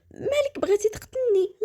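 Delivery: high, tearful plea; terrified wife crying out to her husband; crying in fear. Acted. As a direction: beg through tears, frightened.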